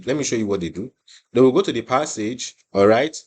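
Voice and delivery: normal talking voice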